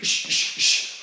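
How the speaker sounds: quick unvoiced shh breaths; sharp breaths with punches. Shown as a demonstration. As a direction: hiss out short breaths like punching. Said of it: "Every strike you throw, breathe".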